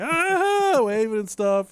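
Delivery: sudden high loud gasp; a startled crowd cry of alarm. Retold or imitated. Crowd reacting to horse.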